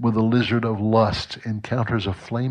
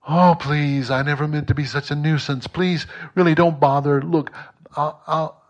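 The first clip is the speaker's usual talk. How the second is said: higher and hurried, begging; pleading ghost; 5 semitones higher than usual. A narrator's reading. Ghost begs the angel not to bother, higher and pleading. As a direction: beg the angel to stop.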